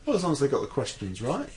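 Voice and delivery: usual speaking voice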